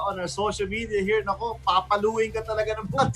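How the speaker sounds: normal talking voice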